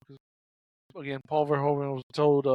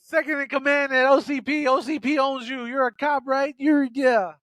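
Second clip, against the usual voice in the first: higher, louder mock bravado; cocky thug; 6 semitones higher, 5 dB louder than usual. Clarence threatening RoboCop with his boss, cocky. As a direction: boast like a smug crook. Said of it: "he's like".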